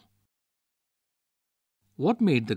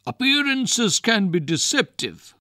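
"Appearances" pitched much higher and louder, falling to the end; bold retort; 9 semitones higher, 6 dB louder than usual. A narrator's reading. Vijay Singh retorting to the ghost, loud and high. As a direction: proclaim it loud and high like a challenge.

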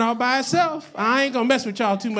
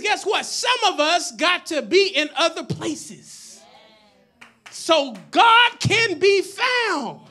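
high, drawn-out preacher's proclamation; full-force preaching; 8 semitones higher, 4 dB louder, slower than usual. Real, not acted. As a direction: proclaim it to the whole congregation, stretching the key words.

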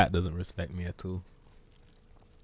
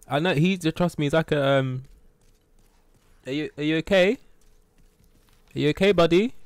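higher, louder coo at the cat; pet talk; 6 semitones higher, 4 dB louder than usual. Real, not acted. Streamer fussing over his cat, higher pet-talk voice.

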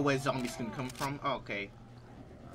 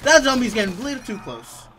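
high, loud alarmed warning; nervous cry of alarm; 7 semitones higher, 12 dB louder than usual. Real, not acted. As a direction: back away from the zombie. Zombie getting too close.

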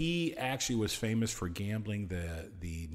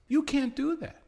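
much higher, louder you can't do that; alarmed objection; 3 dB louder than usual. Retold or imitated. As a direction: squeal it as a shocked protest. FCC objecting, said twice.

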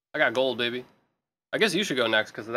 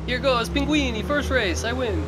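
put-on Italian accent; 11 semitones higher, slower than usual. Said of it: "horrible Italian accent".

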